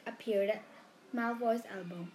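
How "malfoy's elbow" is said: ordinary delivery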